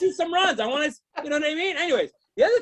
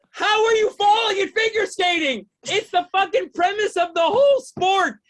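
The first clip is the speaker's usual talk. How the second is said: raised, higher-pitched comic disbelief; loud stand-up indignation; incredulous outrage; 4 semitones higher, 3 dB louder than usual. Acted. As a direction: berate the skaters in exasperation. Incredulous rant at skaters.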